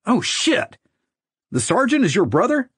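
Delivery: high-pitched alarmed disbelief; stunned, rising question; startled realization. A narrator's reading. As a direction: blurt it out as it dawns on you. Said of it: "immediately changed his tune".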